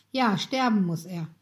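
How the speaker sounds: usual speaking voice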